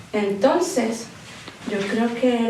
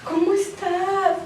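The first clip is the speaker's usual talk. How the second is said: slow, soft, tender and higher; gentle question; 7 semitones higher, 3 dB quieter, much slower than usual. Retold or imitated. Sister greeting her heartbroken sister on a video call, slow and tender. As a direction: ask it slowly and softly, holding back tears. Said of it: "Y le digo".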